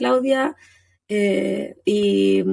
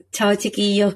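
normal talking voice

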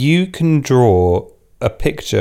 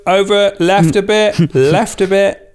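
higher, louder directions; calling out; 10 semitones higher, 4 dB louder, slower than usual. Pretend. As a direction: shout directions at a dancer. Calling out live feedback.

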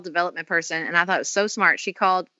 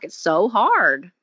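usual speaking voice

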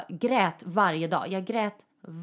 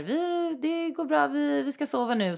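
higher, innocent reply; 8 semitones higher than usual. Retold or imitated. Her guilty text to the ex's friend, lightly higher and innocent. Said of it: "när jag skickade det här".

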